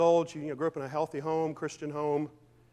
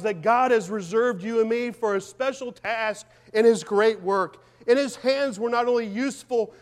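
louder and higher, building to a peak; fervent preaching read; 7 semitones higher, 8 dB louder than usual. Acted. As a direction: preach her words with rising fire. Reads her written words fervently.

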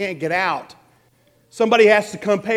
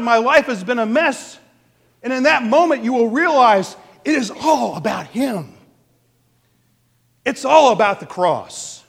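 loud, raised-pitch preaching; impassioned preaching; 5 semitones higher, 5 dB louder than usual. Real, not acted. Preacher warns congregation of the judgment, impassioned. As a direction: drive it home at full voice.

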